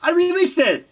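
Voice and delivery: mock triumphant cry from an artist with no plan; excited brag. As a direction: toss it off like a proud artist bragging. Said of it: "and they're like".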